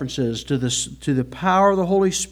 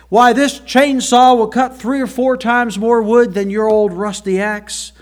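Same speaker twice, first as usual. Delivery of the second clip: put-on salesman's Southern accent; 6 semitones higher, 6 dB louder than usual. Salesman keeps pitching the chainsaw.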